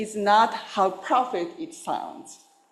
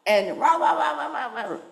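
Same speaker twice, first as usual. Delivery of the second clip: light yappy mock barks, rah rah; imitated dog barking; 5 semitones higher than usual. Teacher imitates his dog charging the geese, mock barking. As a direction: bark it out playfully like the dog. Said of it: "barking very loudly".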